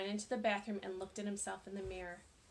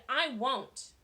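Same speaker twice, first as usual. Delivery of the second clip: louder, angry outburst; exploding; 3 semitones higher, 7 dB louder than usual. A narrator's reading. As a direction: burst out in anger. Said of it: "Colleen exploded".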